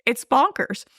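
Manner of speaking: very high, laugh-squeezed voice; giggly, incredulous high pitch; amused disbelief. Real, not acted. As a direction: squeak it out, still cracking up. Still giggling at absurd movie.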